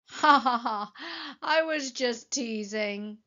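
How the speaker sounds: acted ha-ha, then slow teasing; laughing. A narrator's reading. Elmer turns back to teased Wilbur, laughing. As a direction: laugh it off in a playful drawl. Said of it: "laughed Elmer".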